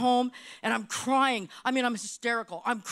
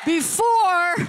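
building to a high, loud mock threat; hammed-up angry threat; 5 semitones higher, 3 dB louder than usual. Restaurant owner telling off the realtor in her head, mock fury. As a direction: build it up into a comic tirade.

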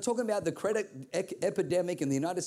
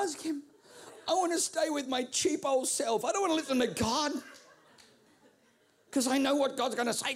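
higher, whiny reluctant voice; stingy inner voice; 9 semitones higher than usual. Acted. Preacher voicing his cheap self refusing to ask God, higher and whiny. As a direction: sulk and refuse.

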